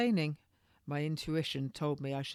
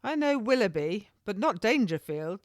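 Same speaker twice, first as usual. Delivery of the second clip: higher, louder insistence; insistent; 5 semitones higher, 5 dB louder than usual. A narrator's reading. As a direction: insist on the difference. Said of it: "I reiterated".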